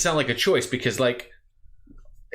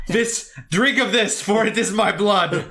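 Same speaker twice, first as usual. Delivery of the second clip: slow, higher solemn Bible quote; solemn preacher voice; 3 semitones higher, slower than usual. Pretend. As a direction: intone it slowly like a priest. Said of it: "And I'd be like".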